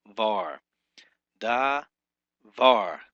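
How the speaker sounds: DA lighter, VAR hit with force and falling; stress on last syllable. Shown as a demonstration. Teacher showing where Hebrew stress falls, stressed VAR. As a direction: say Da and Var apart, leaning hard on Var. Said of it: "the stress or the force of the pronunciation falls on the last".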